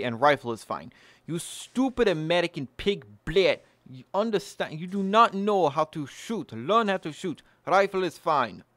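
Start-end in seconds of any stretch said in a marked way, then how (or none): 1.2-7.4 trashy mock Russian accent, higher and scolding; a hammed-up Russian gun snob lecturing an American. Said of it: "That's my Russian accent". 7.6-8.6 "Rifle is fine" in a hammed-up Russian accent, dropping the article. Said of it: "That's my Russian accent".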